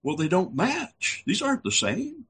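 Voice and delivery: lightly voiced buddy comparing the copies; puzzled buddy. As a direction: point out the mismatch, baffled. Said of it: "the guy came back and said".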